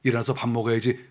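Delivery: put-on mother gently waking her child for school; gentle mother. Shown as a demonstration. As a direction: wake a sleeping child lovingly. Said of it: "조용히 가서 사랑하는 마음으로".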